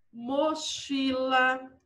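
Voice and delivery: drawn out, syllable by syllable; slow dictation. Shown as a demonstration. Teacher giving pupils a three-syllable example, slowly dictated.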